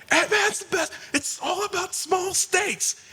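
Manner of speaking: very high-pitched, strained mock argument; mock impassioned yell. Re-enacting a movie debate for the security guards, mock impassioned yelling. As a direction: yell it like a heated fan debate, stretching the end.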